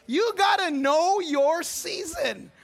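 slow, drawn-out preaching line; preaching refrain. Acted. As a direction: lean on it like a sermon refrain.

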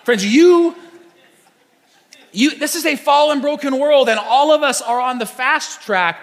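loud, high, urgent sermon voice; preaching at full force. Acted. Preaching climax.

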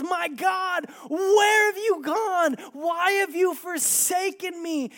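high, loud and slow crying out; anguished shout. Acted. Preacher voicing Jesus crying out to God, anguished shout. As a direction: tear it out as a desperate plea to heaven.

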